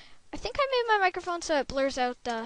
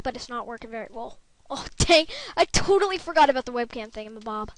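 ordinary talk, nothing marked

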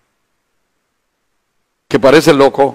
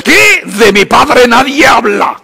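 mock indignant outburst; 4 semitones higher than usual. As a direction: ham up a touchy son's outrage.

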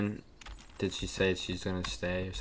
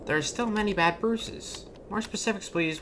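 high-pitched game character voice; 11 semitones higher than usual. Acted. Reading game dialogue aloud, high character voice.

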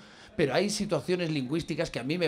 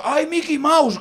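higher and louder "Ay, Mickey Mouse" cry; Antonio el Gitano's cry; 8 semitones higher, 6 dB louder, slower than usual. Retold or imitated. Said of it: "Antonio el Gitano ... que decía". (Antonio el Gitano gritando al volante)

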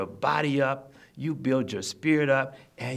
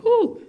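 high, loud whoo; exclaimed whoo; 9 dB louder than usual. Acted. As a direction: let out a high whoo at something potent. Exclaims at something very potent.

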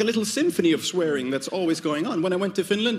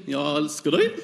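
Norwegian "Jag älskar dig", ending with a leap up; Norwegian sing-song; 3 semitones lower than usual. Shown as a demonstration. Mocking Norwegians to the crowd, pitch leaps up at the end. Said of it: "Norwegians always end everything by jumping an octave".

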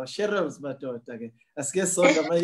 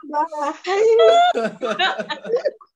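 high, loud burst of laughing; loud laughter; 13 semitones higher, 9 dB louder than usual. Real, not acted. Host overcome by the joy of the Lord, loud laughter.

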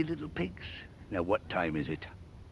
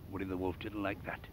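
ordinary delivery

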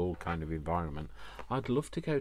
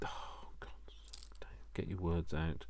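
real whisper to oneself; whispered self-scolding; 3 semitones lower, 6 dB quieter than usual. Real, not acted. Vlogger lost for words talking to herself, whispered self-scolding. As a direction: whisper it under your breath, chiding yourself.

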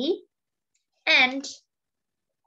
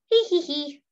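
higher cheeky monkey giggle; mock giggle; 7 semitones higher than usual. Monkey laughing at his trick, mock giggle. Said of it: "And the monkey says".

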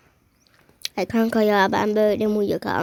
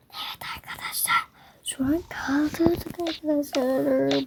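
hushed whisper to the camera; 5 semitones higher, 4 dB quieter than usual. Real, not acted. Whispering to viewers while pranking a sleeping friend.

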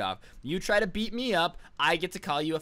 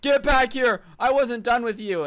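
higher, louder, furious yell; mock yell; 6 semitones higher, 7 dB louder than usual. The angry kid shouting after him as he leaves, loud yell. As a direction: bellow after someone walking away. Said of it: "the kid's like".

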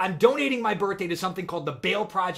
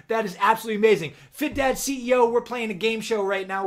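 usual speaking voice

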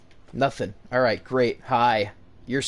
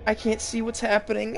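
usual speaking voice

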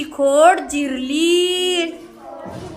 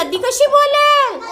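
higher, disbelieving voice; Mai asking in disbelief; 8 semitones higher than usual. Acted. Teacher voicing Mai doubting the river, higher voice. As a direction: ask it as if the idea is absurd. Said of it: "माई म्हण ना".

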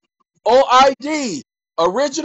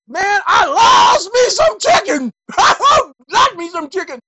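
high, loud, slow mock cry with a laugh; hammed-up caricature; 9 semitones higher, 7 dB louder than usual. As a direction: wail it like a comic caricature.

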